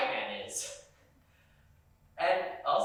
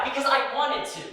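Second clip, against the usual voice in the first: higher and louder, insisting hard on wanted; defiant repeat; 9 semitones higher, 6 dB louder than usual. Retold or imitated. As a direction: throw it back at him, refusing to back down.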